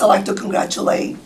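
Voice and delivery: "congratulate" almost whispered, no voice; hoarse, voice nearly gone; raspy whisper. Real, not acted. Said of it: "Sorry, I have no voice tonight".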